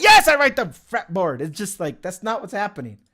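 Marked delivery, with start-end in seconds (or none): 0.0-1.4 loud, higher, fed-up reply; irritated mock answer; exasperated retort. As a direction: snap back at a useless tip. Annoyed retort to Google's advice.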